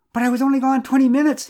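loud, high-pitched indignant protest; storyteller's wailing excuse. A narrator's reading. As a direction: object loudly, voice held way up high. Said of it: "he protested".

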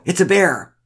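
high-pitched cry of alarm; called warning. A narrator's reading. Man tells his friends what he has spotted, called out. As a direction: call it out as an urgent alert. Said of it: "he called".

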